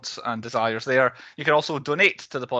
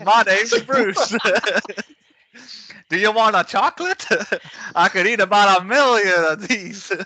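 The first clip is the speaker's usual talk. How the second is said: high, slow Alabama drawl between giggles; slow, high, dreamy Southern storyteller introducing guests; Southern drawl impression; 6 semitones higher, 6 dB louder than usual. Continues Southern movie-character impression.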